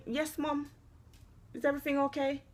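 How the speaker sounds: soft, gentle polite daughter. Shown as a demonstration. Mother models a gentle reply to her daughter. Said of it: "you can say".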